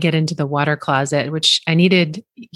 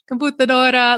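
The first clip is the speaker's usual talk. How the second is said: mock Italian; 8 semitones higher than usual. Mangling Italian in a shop.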